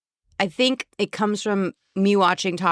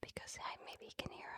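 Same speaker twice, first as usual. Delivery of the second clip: barely audible, cautious whisper; real whisper; 26 dB quieter than usual. Real, not acted. Co-host worried he can hear, whispering very softly. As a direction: explain it under your breath. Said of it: "Why are you whispering?".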